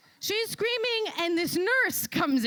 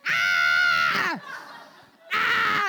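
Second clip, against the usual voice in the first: loud mock yell of a woman in labor; imitated labor scream; 6 semitones higher, 4 dB louder than usual. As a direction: belt out a hammed-up birth scream. Wife screaming in labor taxi.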